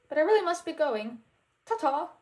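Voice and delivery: posh dismissal. Acted. Prince escaping Goofy, airy posh farewell. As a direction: breezily excuse yourself.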